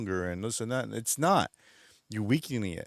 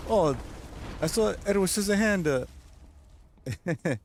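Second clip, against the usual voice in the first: gushing movie fan; 7 semitones higher than usual. Retold or imitated. Nephew changes the subject, gushing about his favorite film. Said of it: "he goes".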